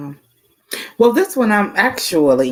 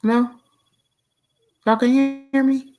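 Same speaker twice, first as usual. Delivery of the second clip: glitchy robotic lag; choppy digital robot voice; 3 semitones higher, faster than usual. Real, not acted. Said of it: "you sound like, kinda like a robot".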